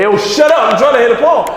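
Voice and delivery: loud, high retold yell; angry shout. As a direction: yell at him to shut up, mimicking. Big dude snaps at crying man.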